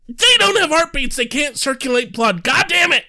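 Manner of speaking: high, loud exasperated outburst; exasperated rant. Real, not acted. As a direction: vent in full frustration. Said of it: "Sounds like someone's in need".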